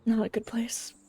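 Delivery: hushed whisper. Acted. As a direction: breathe it as a whisper. Joking aside about internet.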